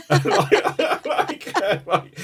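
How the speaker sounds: words broken by laughter; giggling mid-sentence; laughing. Real, not acted. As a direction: laugh through it. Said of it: "laughs through words".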